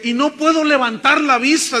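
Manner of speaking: high, loud preacher's declamation; declaimed scripture. Acted. Preacher quoting David's psalm to the congregation, declaimed loudly. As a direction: proclaim the verse at full voice.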